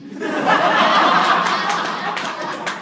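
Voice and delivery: loud high cackling laughter; huge laugh. Real, not acted. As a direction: burst out laughing hard. Laughs at his outburst.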